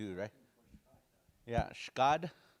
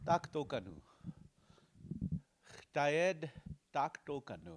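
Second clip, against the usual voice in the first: ordinary delivery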